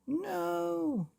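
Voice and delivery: high, terrified scream. Acted. Father crashing toward the house, screaming in fear. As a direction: shriek as you fall. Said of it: "he screamed".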